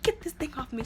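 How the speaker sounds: a hammed-up order to get it off; mock demand. Woman demands necklace removed.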